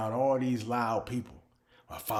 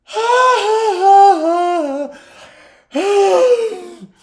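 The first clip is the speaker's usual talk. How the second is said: a big, loud, drawn-out hammed-up yawn sigh; noisy exaggerated yawn; 18 dB louder than usual. Shown as a demonstration. Mocking the noisy yawners he can't stand, a loud drawn-out yawn. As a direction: blow the yawn out long and loud like the people you hate.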